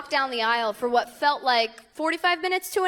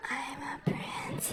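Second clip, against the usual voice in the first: breathy whispered self-adoring bride; mock bride whisper; 14 dB quieter, slower than usual. Bride savoring her slow walk down the aisle, whispered. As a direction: whisper it like a smug princess bride.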